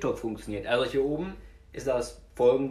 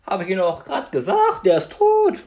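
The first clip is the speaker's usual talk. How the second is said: exasperated shout; 7 semitones higher, 6 dB louder than usual. Acted. Annoyed witness in skit.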